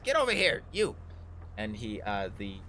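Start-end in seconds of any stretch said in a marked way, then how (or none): 0.0-1.0 high, loud order to an underling; bossy villain's yell; barked summons. Acted. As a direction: call the henchman over sharply. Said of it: "and he goes".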